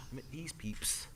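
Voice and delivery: whispered aside; hushed teasing whisper. Real, not acted. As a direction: drop to a breathy whisper. Whisper at end of story.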